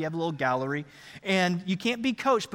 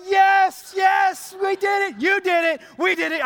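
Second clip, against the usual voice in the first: high, loud victory shouts; jubilant celebration; 9 semitones higher, 5 dB louder than usual. Acted. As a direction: whoop with your winning child. Dad celebrating the win.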